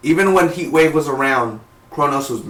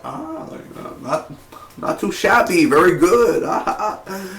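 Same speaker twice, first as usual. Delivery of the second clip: higher, chuckling impressed voice; amused approval; 6 semitones higher than usual. Retold or imitated. Mimicking Ra's al Ghul seeing the Atom, chuckling. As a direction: laugh it off, impressed. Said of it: "and he was like".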